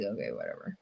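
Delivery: resigned dismissal. Retold or imitated. After the insurance agent says there is nothing to do, deflated. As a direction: mutter it off with a shrug.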